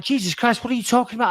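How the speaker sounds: retold irate protest. Passenger arguing with gate agent, exasperated irate protest. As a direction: argue back in disbelief. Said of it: "I'm getting irate".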